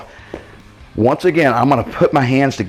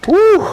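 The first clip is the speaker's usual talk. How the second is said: high, loud whoop of relief; triumphant whoop; 7 dB louder than usual. Real, not acted. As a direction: let out a big victory whoop. Finishing the set.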